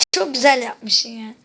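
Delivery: loud, slow mock compliment to the map maker, "güzel" stretched; loud sarcastic praise to the map maker. Real, not acted. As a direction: sneer the praise loudly. Sarcastic praise after cursing.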